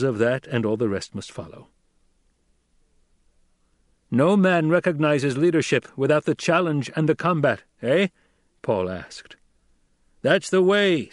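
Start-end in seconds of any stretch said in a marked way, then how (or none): 4.1-8.1 higher, louder, commanding orator; young duke addressing tribe. A narrator's reading. Paul addresses the throng. 10.2-11.1 higher, louder call from the throng; shouted from the crowd. A narrator's reading. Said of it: "someone shouted".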